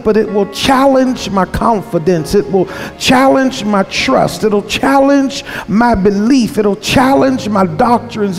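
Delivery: ordinary talk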